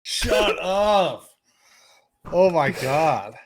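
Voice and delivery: high, loud mock disbelief; retold astonishment. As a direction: act out a drawn-out stunned reaction. Retells reaction to being spotted online.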